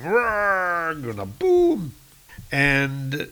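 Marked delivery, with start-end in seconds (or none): 0.0-0.9 high, drawn-out mock roar; hammering roar. 1.2-1.9 drawn-out, high comic-book boom; booming impact. Acted. Voices the blow's sound effect.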